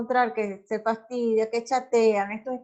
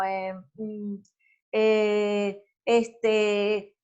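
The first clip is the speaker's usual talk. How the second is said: hesitant drawn-out eh and mm; nervous fillers; much slower than usual. Shown as a demonstration. Teacher demonstrating nervous filler sounds, hesitant. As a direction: stall like a nervous speaker afraid of silence. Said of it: "entonces empezamos como".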